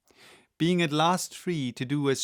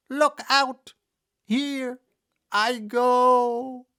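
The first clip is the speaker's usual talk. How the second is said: high, loud witch wail, fast at times; dying witch wailing her last; melting witch, despairing; 7 semitones higher, 4 dB louder than usual. A narrator's reading. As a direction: lament your end as you melt.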